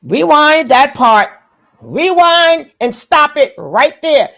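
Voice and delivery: loud, urgent, demanding a replay; excited call to rewind. Real, not acted. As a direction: call out loudly to rewind the clip. Reactor demands rewinding the clip.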